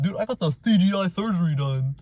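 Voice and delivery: lightly voiced proud cartoon; mock Ugly Sonic. Mocking Ugly Sonic bragging, dude voice. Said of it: "He's like".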